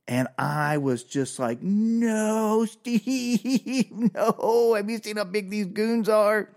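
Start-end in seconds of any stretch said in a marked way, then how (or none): 1.6-6.5 high, laughing, panicked pleading to Steve; panicked high plea. Retold or imitated. Teller begging Steve to back down from the goons, panicked and laughing. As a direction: beg him to stop, half laughing in fear.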